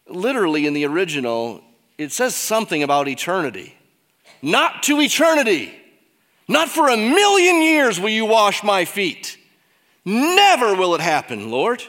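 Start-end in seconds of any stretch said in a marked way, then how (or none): 4.4-11.8 louder, higher mock protest; emphatic refusal. Preacher playing Peter refusing Jesus, loud and higher. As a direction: Peter digging in his heels, hammed up.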